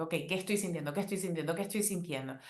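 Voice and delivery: quietly asking herself what she feels; soft inner questioning. Retold or imitated. Herself, mid-day check-in: soft, inward murmur.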